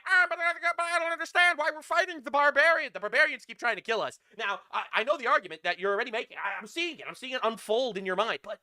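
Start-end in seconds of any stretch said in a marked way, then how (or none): 0.1-2.9 higher, louder whiny parody; mocking critic voice. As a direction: whine it like a naive critic. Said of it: "everyone's like".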